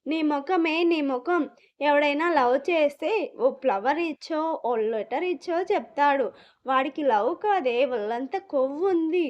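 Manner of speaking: usual speaking voice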